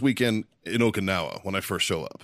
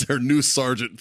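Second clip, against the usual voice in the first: normal talking voice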